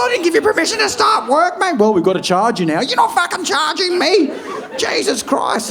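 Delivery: mock furious customer; outraged shout. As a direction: yell at the plumber down the phone. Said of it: "Terry furious at plumber".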